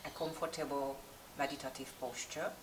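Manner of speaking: calm, unhurried yoga meditation with long pauses; guided meditation. Real, not acted. Yoga teacher settling class before practice, guided meditation voice. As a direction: guide the class inward, leaving space between instructions.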